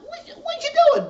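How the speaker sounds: high, stammered disbelief; play-acted high-pitched shock; mock shock. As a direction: squeal it as a scandalized caller. Retold shocked woman on phone.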